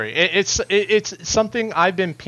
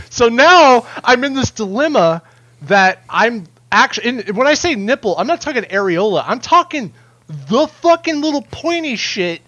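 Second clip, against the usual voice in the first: excited; 6 semitones higher, 5 dB louder than usual. Real, not acted. Laughing rant about nipple tattoo.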